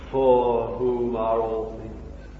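each word laid down slowly; slow, deliberate. Shown as a demonstration. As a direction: spell it out slowly for the hearers. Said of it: "You see?".

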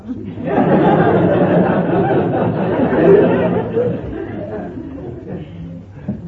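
a long, breathy burst of laughter; real amused laughter. Real, not acted. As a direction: laugh hard at your own joke. Preacher laughs at own joke.

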